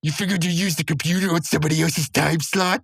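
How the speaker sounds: high-pitched indignant rant; berating. Acted. Muscle Man berating Mordecai over the time slot, acted indignation. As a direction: chew him out in a put-on high voice. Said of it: "he's just, like, berating him".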